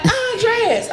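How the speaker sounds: put-on high, small kid's voice answering; a little boy answering his mom's call, high and small; child's high reply. Son answers mom from the flipped car, high and childlike. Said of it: "He like".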